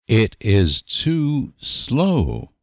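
normal talking voice

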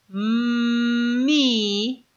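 "mi" with a long hummed m gliding into i; phonics-style stretched "mmmi"; joining two sounds. Shown as a demonstration. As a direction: hold the m of "mi" before the vowel. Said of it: "si juntamos los dos sonidos".

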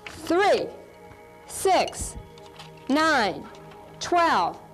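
only the threes said, high and clear; skip counting aloud. Shown as a demonstration. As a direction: call out three, six, nine, twelve. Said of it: "so we can't even hear the whispered ones".

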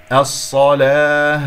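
الصلاة slow and loud, heavy doubled saad; strong drawn-out الصلاة; heavy saad demo. Shown as a demonstration. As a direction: recite الصلاة loudly, stretching the vowel. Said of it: "صاد".

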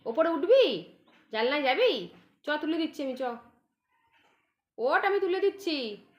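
high pet-coaxing voice. Real, not acted. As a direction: coax the cat up, high and bright. Coaxing the cat upward.

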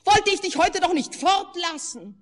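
high, loud whining reproach; tearful reproach. A narrator's reading. The wife reproaches her husband, tearful high whine. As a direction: plead through tears.

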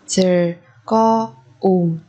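slow, drawn-out repeat for learners; slow drill. Shown as a demonstration. Teacher to students, slow drill repeat. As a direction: stretch each syllable for the class. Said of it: "teacher repeats word slowly".

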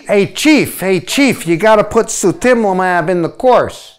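a hint of Brooklyn, calling out to the Chief; Brooklyn accent. Colleague Ed urging the Chief to add a drug, in a light Brooklyn accent. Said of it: "Why do you sound like you're from Brooklyn".

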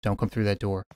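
hushed, pleading whisper; whispering while hiding. Real, not acted. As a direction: beg under your breath. Hiding, begging owner not enter.

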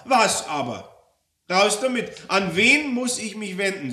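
high, loud interrogation; impatient, forceful questioning; angry demand. Acted. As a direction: press hard for an answer. Customer demands name of superior.

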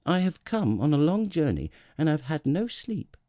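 put-on high, pleading child-prince voice; earnest little prince. Little prince explains his tiredness to the king, pleading. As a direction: plead your excuse in a high voice.

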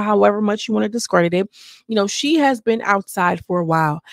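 usual speaking voice

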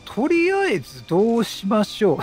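ordinary delivery

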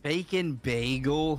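slow, low teasing echo; mocking slow echo. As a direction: drag it out to tease him. Mocking friend's order.

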